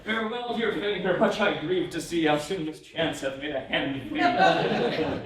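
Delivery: giggling through the last couplet; slowed, laughing poem reading. Real, not acted. Reads poem ending while laughing.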